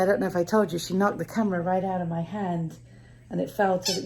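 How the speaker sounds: ordinary delivery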